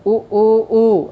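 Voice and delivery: "उ" said short, then longer, then drawn out longest; short, long, overlong u. Shown as a demonstration. Teacher showing the students three lengths of "उ". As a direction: give each "उ" one, two and three beats in turn. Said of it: "ऐसे ह्रस्व".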